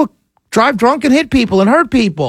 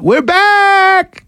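excited shout; 12 semitones higher than usual. Acted. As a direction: belt it out high. Jingle-like shout.

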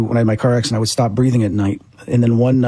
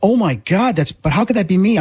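higher, stunned disbelief at seeing his own body; shocked; 8 semitones higher than usual. Retold or imitated. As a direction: gasp it out in shock.

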